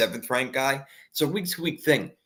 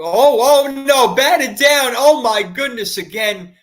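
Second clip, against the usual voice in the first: loud, high dismayed yell; shocked shout; 12 semitones higher, 11 dB louder, slower than usual. Real, not acted. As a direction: cry out in sudden dismay. Interception, batted ball, shocked fan.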